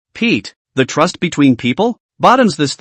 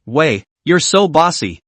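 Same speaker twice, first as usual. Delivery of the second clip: ordinary delivery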